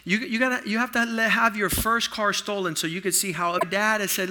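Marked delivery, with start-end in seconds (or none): none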